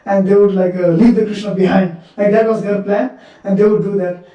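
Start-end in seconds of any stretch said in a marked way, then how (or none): none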